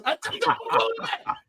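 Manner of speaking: high laughing words. Real, not acted. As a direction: laugh through the line. Laughing mid-story.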